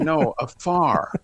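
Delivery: "afar" said slowly and pointedly; slow, deliberate "afar"; slow correction. Retold or imitated. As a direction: spell out "afar" patiently for a confused listener. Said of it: "I said".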